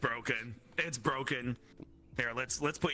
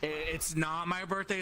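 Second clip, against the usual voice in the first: usual speaking voice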